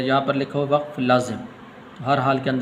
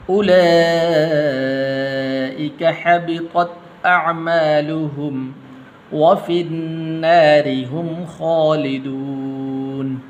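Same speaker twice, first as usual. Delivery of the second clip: slow melodic Quranic chant; Quran recitation; 3 semitones higher, 5 dB louder, much slower than usual. Shown as a demonstration. Teacher reciting the verse to students, measured chant. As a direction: recite the verse slowly with tajweed.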